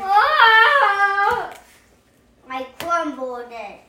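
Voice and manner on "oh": high, loud wail of dismay; distressed cry. Real, not acted. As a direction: cry out as the paper crumples. Child crumples her paper.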